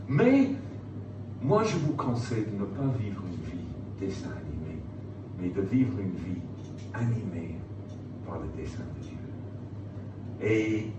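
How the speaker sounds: normal talking voice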